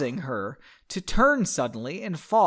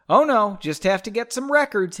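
lightly acted cheer, higher and louder; hopefully cheerful; 5 semitones higher, 5 dB louder than usual. A narrator's reading. Henry covering up to a mage, mildly forced cheer. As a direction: put on a slightly forced cheerful voice. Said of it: "in what he hoped was a cheerful voice".

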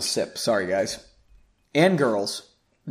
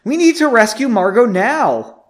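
high, loud, indignant protest; raised-voice disbelief and urgency; shocked outburst; 8 semitones higher, 8 dB louder than usual. A narrator's reading. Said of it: "with a look of shock".

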